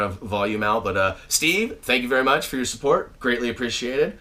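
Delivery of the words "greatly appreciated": ordinary talk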